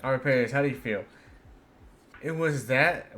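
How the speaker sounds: ordinary talk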